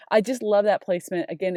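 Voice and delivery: ordinary talk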